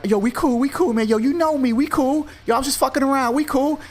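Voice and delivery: very high, squeaky backing-off plea; scared thug voice. Retold or imitated. Mugger backing off from staple gun, very high scared plea. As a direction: squeal it, hands up, begging for peace.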